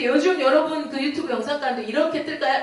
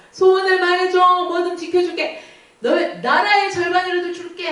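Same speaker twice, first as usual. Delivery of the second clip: higher, louder eager offer; a doting king, pitched up and loud; 6 semitones higher, 4 dB louder than usual. Acted. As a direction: promise her anything, bursting with eagerness.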